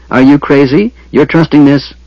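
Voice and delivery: mildly yelled objection; indignant yell. A narrator's reading. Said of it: "he yelled".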